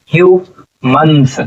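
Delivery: Humans slowly split into hue and मन्स; syllable by syllable. Shown as a demonstration. Teacher sounding out humans for students, slowly split in two. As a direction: sound out humans with a pause between its two parts. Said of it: "क्या हो जाएगा".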